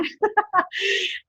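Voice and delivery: bright burst of laughter at her own joke; amused laugh after the retold scolding. Real, not acted. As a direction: let a real laugh break out.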